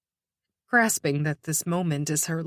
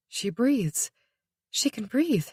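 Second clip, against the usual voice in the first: ordinary delivery, nothing marked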